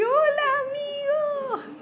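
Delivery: high, stretched-out greeting called back; calling back. Retold or imitated. The speaker calling back to the man, high and stretched. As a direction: answer the call from afar, drawn out.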